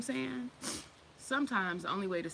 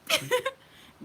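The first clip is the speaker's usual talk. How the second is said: high shriek of laughter; real laughter; laughing; 8 dB louder than usual. Real, not acted. Laughs again.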